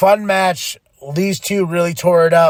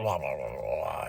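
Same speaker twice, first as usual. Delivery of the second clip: dismissive blah; 13 dB quieter than usual. Real, not acted. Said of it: "dismissive filler".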